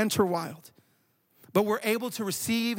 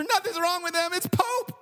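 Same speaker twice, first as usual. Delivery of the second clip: high-pitched mock panic, climbing at the end; imitated terrified daughter; 8 semitones higher than usual. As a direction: act out a frantic kid on the phone. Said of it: "hear that kind of terror on the phone".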